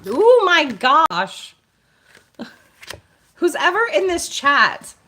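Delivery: high, loud burst of excitement; excited exclamation. Real, not acted. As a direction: cry out in amazement to the chat. Awaited card turns up during live reading.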